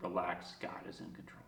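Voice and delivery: barely audible, low whisper; hushed, whispered reassurance; awed whisper. Retold or imitated. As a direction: breathe the message to yourself. Repeats the sign in awe.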